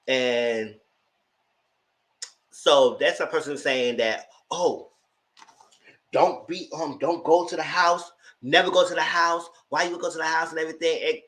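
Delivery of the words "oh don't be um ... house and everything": a bit higher, pressing the catfish's plea; pleading catfish who won't let it go; pushy catfish. Retold or imitated. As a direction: push hard like the desperate catfish. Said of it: "so adamant and so hyped about the house".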